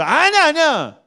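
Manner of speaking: high-pitched mock protest; insistent denial. Preacher acts the world objecting, insistent denial.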